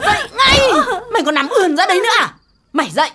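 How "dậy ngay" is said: higher, snapped command; sharp order. Acted. Mother ordering lazy daughter up. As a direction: bark her out of bed.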